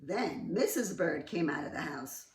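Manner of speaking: ordinary delivery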